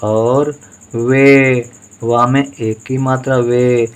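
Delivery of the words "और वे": very slow, stretched out; drawn out syllables. Shown as a demonstration. Teacher dictating a Hindi word to pupils, drawn out slowly. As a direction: sound it out slowly for the class. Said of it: "teacher sounds out word".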